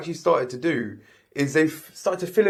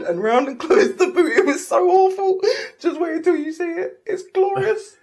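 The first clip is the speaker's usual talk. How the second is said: laughing through the words; can't stop laughing while telling it. Real, not acted. Laughing about the taped boot.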